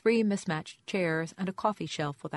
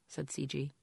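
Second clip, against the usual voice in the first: plain reading voice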